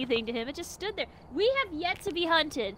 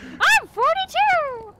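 put-on high, slow, loud childish shout; quoting a child; 14 semitones higher, 7 dB louder, much slower than usual. Repeating the meme to explain the joke, slow, high and loud like a child. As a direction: drag it out like a boastful little kid. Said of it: "the joke is that, like, a six-year-old says it".

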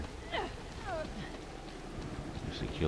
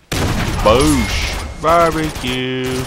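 slow, stretched, higher gleeful "Barbecue"; gloating callout; 8 semitones higher, 7 dB louder, much slower than usual. Real, not acted. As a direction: savour the kill out loud.

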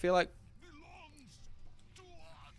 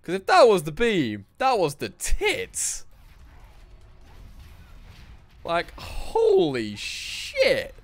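high, drawn-out awe; excited awe; 9 semitones higher, 6 dB louder, slower than usual. Real, not acted. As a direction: gush over it. Amazed at the beam.

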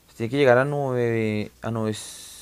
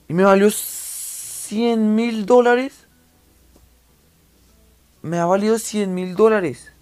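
excited; 9 semitones higher, 6 dB louder, much slower than usual. Real, not acted. Shocked at the house price.

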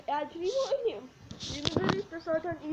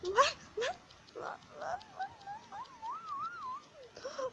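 climbing higher and higher, breathy squeal; rising squeal; 5 dB quieter, much slower than usual. Real, not acted. Gamer squealing as an enemy chases him.

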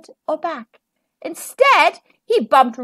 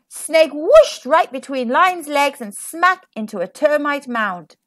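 plain reading voice

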